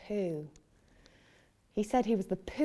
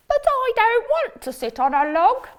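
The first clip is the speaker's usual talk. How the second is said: high, loud protesting frog voice; whiny frog; 14 semitones higher, 10 dB louder than usual. Acted. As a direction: complain like a stubborn little frog. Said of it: "said the frog".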